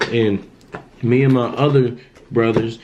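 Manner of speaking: usual speaking voice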